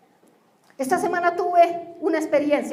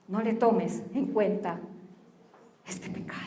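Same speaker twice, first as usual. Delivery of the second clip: whispered forgiveness; 6 semitones lower, 8 dB quieter than usual. Acted. Stephen forgives his killers.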